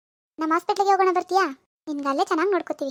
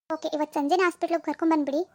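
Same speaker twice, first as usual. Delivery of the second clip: normal talking voice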